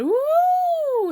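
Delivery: very high, loud whooping cry. A narrator's reading. Piglet flying up in the pocket, very high whoop. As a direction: whoop as you fly up. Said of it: "he said".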